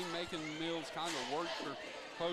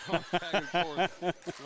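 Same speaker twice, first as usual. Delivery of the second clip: laughing; 8 dB louder, much slower than usual. Real, not acted. Laughing about commentating.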